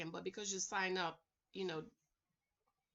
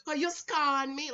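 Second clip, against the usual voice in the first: put-on Jamaican accent; 4 semitones higher, 6 dB louder than usual. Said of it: "And they'd be like".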